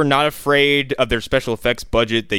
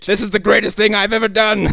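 raised-pitch hammed-up triumph; exulting mad scientist; 7 semitones higher than usual. Doctor after the T-Rex bites driftwood, mock exultation. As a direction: play the doctor overjoyed with himself.

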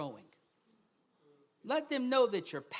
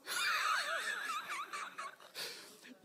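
big laugh. Real, not acted. Preacher laughing at the fries line, big high laugh. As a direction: crack up at your own joke.

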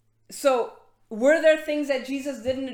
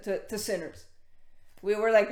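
normal talking voice

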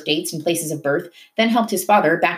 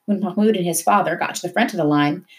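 ordinary delivery, nothing marked

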